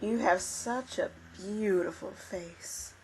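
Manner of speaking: soft, slow angel voice; creepy hushed sweetness; eerie angel. Acted. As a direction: coo it slowly and quietly, drawn out. Game master voices eerie angel.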